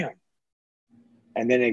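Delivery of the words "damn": breathy, much softer; a barely voiced worried curse; hushed worry. Nervous driver after chest pain, barely voiced.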